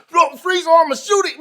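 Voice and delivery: high, loud barked threat; high, loud threatening shout; retold shout. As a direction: yell it like a cop with a gun drawn. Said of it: "He like".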